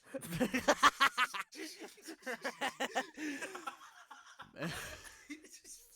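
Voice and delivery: long, high, loud laughter; high-pitched helpless cackling; uncontrollable laughing fit. Real, not acted. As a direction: crack up completely and keep going. Cracking up at the slip.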